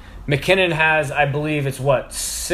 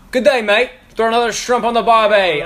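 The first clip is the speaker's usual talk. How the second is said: hammed-up Aussie, pitched up; mock Australian, high and loud; Australian accent; 7 semitones higher, 4 dB louder than usual. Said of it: "he's in Australia right now".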